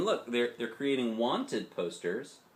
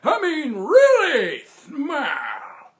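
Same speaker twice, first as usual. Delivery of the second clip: put-on high, loud, very slow old man demand; insistent grandpa; 12 dB louder, much slower than usual. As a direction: insist hard like a cranky grandpa.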